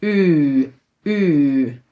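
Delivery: "U" held as Dutch uu; "U" with rounded lips, sustained; long rounded vowel. Shown as a demonstration. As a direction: draw out each "U" as a low ü. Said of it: "That's maybe a hard one".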